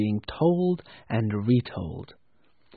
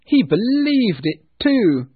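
high, loud amused exclaim; loud cry; 10 semitones higher, 9 dB louder than usual. Acted. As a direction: cry it out with glee. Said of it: "cried Scrooge's nephew".

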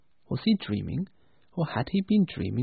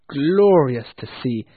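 usual speaking voice

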